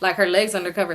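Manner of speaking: ordinary talk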